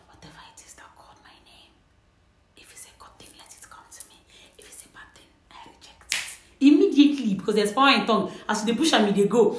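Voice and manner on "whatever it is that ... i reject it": barely audible, voiceless whisper; whisper back. Shown as a demonstration. Viewer replying to a whispered name, secret whisper. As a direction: whisper it under the breath, very softly. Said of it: "whisper back".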